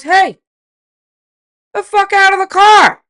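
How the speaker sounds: loud, high yell at a stranger; retold angry yell. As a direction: bark him out of the car. Husband yelling at stranger.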